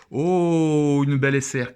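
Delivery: long, loud, high "oh" of delight; excited discovery. Real, not acted. Collector reveals a beautiful rare card, excited. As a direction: burst out high, then settle on the card. Tire une carte rare.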